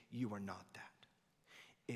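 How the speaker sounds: a hushed, breathy whisper. Acted. Pastor telling a hurting listener, whispered. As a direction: lean in and whisper it. Intimate aside to congregation.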